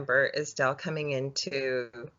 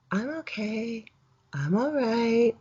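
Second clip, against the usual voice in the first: higher, drawn-out imitation of an uncanny voice; slow, strange, raised-pitch reassurance; eerie altered voice; 6 semitones higher, slower than usual. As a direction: imitate someone sounding not quite himself. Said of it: "it was his voice ... but it wasn't".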